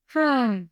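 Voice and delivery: high falling demonstrative sigh; audible sigh. Shown as a demonstration. Coach showing listeners how to release body tension, audible sigh. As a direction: let out a big audible sigh, high and sliding down. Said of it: "like an audible sigh of going".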